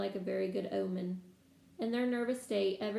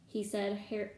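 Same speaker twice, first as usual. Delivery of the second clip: ordinary delivery, nothing marked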